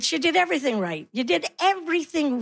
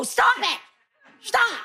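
high, sharp cut-off command; exasperated order; 8 semitones higher, slower than usual. Acted. Mother to bickering kids in car, exasperated order. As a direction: break up the fight, pitched up high.

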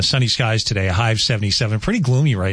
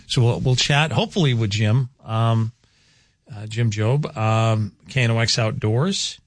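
ordinary talk

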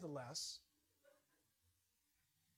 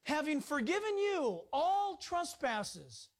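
slow, loud, higher preaching read; emphatic scripture reading; 6 semitones higher, 4 dB louder, slower than usual. Acted. Preacher reading Colossians to the congregation, slow loud proclamation. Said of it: "I'm gonna read that one more time".

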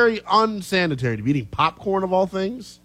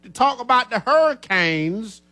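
slow, raised Southern accent; drawn-out Southern delivery, pitched up; 5 semitones higher, slower than usual. Shown as a demonstration. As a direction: play up the Southern, stretching every word. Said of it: "That was real Southern to me".

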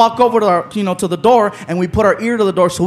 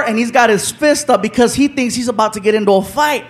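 ordinary talk, nothing marked

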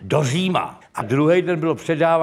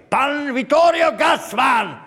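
high, drawn-out announcer's call; imitated ceremony announcer, stretching the name; shouted stage announcement; 6 semitones higher, slower than usual. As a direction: bellow the guest's name to a hall. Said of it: "oni řvali".

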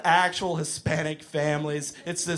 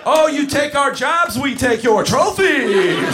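higher, louder, slow sneering taunt; villain wrestler mocking the audience, loud sneer; heel wrestler; 7 semitones higher, 8 dB louder, slower than usual. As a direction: rub it in the crowd's face. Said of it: "pretend to be a villain and a bad guy wrestler".

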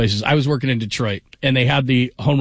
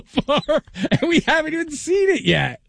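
laughing; 13 semitones higher than usual. Real, not acted. Teasing co-host's gripe.

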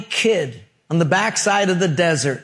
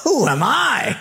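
high-pitched, incredulous protest; hammed-up reluctant prophet; Moses; 7 semitones higher than usual. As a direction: cry out in disbelief at being picked. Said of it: "You know what Moses' answer was?".

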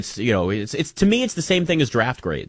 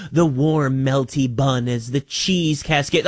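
mock food commercial; slower than usual. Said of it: "describing food and they're like".

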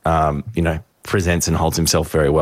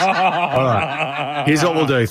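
laughing; 7 semitones higher, slower than usual. Real, not acted. Laughing, resetting the debate.

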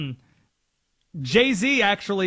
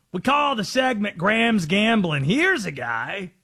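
drawn-out, put-on sportscaster impression; Collinsworth; 2 semitones higher, slower than usual. Host teasing a betting segment as an impersonated commentator. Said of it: "Might just do it as Collinsworth for a second".

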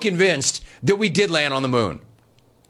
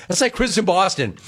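normal talking voice

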